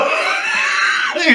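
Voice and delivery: loud, high burst of laughter; high shouted laugh. Real, not acted. Cracking up over the Me Too scare, a loud high laugh. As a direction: crack up hard. Big laugh at his own story.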